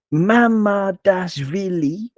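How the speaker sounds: "Mamardashvili" in five evenly weighted syllables; equal stress. Shown as a demonstration. Podcaster demonstrating a Georgian name, equal syllable stress. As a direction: give every syllable of "Mamardashvili" the same beat. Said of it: "pronounce them all with the equal stress".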